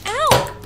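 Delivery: high loud yelp of pain. Acted. Sister hit in the eye, yelping in pain. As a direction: cry out sharply as if hit.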